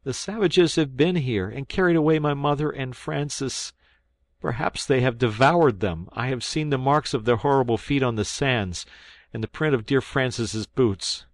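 a boy blurting out terrible news in a mock sob; sobbed out. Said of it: "he sobbed out".

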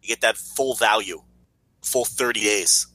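ordinary delivery

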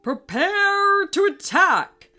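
battle command. Acted. King Mallard orders attack.

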